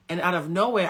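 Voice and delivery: ordinary delivery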